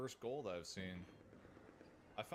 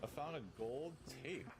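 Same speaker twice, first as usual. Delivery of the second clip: ordinary talk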